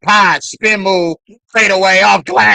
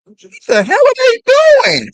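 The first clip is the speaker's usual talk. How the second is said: much higher, faster disbelieving squeal; high exasperated repeat; 3 dB louder, faster than usual. Real, not acted. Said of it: "repeats the question higher".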